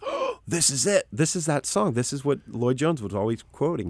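high, amazed rush of recognition; excited realization. Retold or imitated. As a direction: relive the thrill of finally finding it. Acts out recognizing the quoted hymn.

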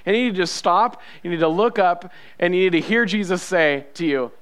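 ordinary delivery

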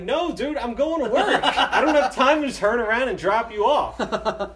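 mock-irritated driver, near laughing; retold exasperated refusal. Driver refuses to turn back.

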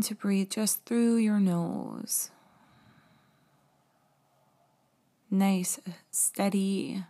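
slow, gentle meditation voice; guided meditation. Acted. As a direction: guide the breathing calmly and slowly. Leads a guided breathing meditation.

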